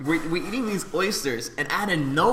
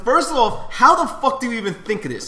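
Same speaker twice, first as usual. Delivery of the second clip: high, loud exasperated protest; embarrassed indignation; 7 semitones higher, 5 dB louder than usual. Real, not acted. Telling his co-hosts how embarrassed he was, exasperated. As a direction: protest it, incredulous and mortified. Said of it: "I'm just so embarrassed".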